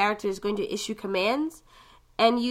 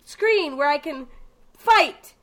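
eager; 9 semitones higher, 6 dB louder than usual. Retold or imitated. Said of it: "and I'm like".

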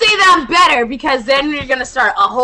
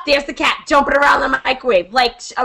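ordinary talk

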